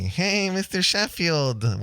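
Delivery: high, drawn-out mock yell; teasing sitcom catchphrase impression, stretched out; Nanny Fine. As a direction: call it out in a long, high whine. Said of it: "come in and yell at me and say".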